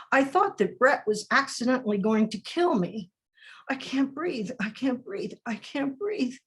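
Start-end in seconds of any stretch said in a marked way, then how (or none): 3.7-6.4 breathless, quiet plea; hushed gasping plea. Acted. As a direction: gasp it out as if smothered. Quoting victim being smothered.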